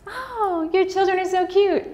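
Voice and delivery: high, cooing imitation of a stranger; gushing stranger. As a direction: coo over the kids like a sweet stranger. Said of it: "I'm out in public again".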